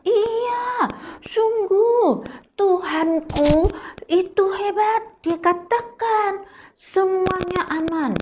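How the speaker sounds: high, sure and eager; confident Paul. Acted. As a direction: assure them firmly. Paul answers the doubting crew. (Paulus yakin dan bersemangat)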